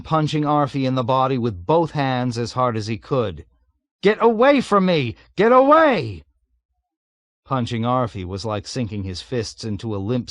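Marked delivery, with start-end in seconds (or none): 4.0-6.2 high, loud desperate shouts; desperate shouting. A narrator's reading. Weeping pilot shouts at navigator. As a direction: shout him away in despair.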